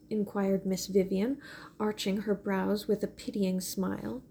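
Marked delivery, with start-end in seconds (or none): none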